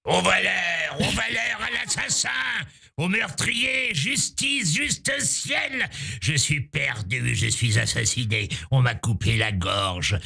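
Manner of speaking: wailing despair, pitched high and strained; Harpagon's ranting tirade. Acted. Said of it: "la tirade d'Harpagon dans l'Avare de Molière".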